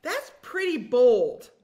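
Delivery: loud, slow "that's pretty bold"; loud, slow astonishment; impressed disbelief. Retold or imitated. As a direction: stretch it out, half amazed. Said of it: "I'm like".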